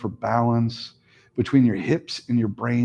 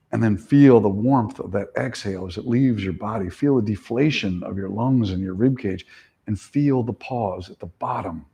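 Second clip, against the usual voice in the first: guided meditation; 3 semitones lower than usual. Acted. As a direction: lull them into the count. Guides breath counting.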